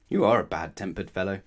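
ordinary delivery

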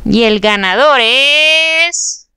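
slow, drawn-out drumroll tease; suspenseful announcement. Acted. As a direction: stretch every word before the reveal. Announcing the giveaway winner. (anuncio con suspenso lento)

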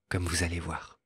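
ordinary delivery

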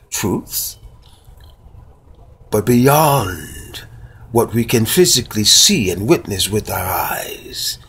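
slow, reflective recitation with long pauses; spoken-word monologue. Acted. Host reciting his little ditty about a wintry day, spoken-word style. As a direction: savour each phrase and let silence hang after it.

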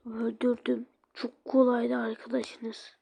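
soft, gloating whisper; the villain doll whispering its boast; menacing whisper. Acted. Villain boasting about the kill.

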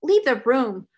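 loud, falling command; stern order. A narrator's reading. Headmistress dismissing the weeping maid, stern. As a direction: order it firmly and loudly. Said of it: "ordered Miss Minchin".

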